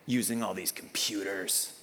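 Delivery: low, slow disgruntled grumble; mock-sour complaint over coffee; grumpy church guy. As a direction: grumble it like an annoyed old-timer. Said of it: "he was just angry about something".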